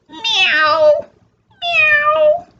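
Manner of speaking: high, long rising meows; cat meow. A narrator's reading. Storyteller voices the cat, high drawn-out meows. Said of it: "The cat says".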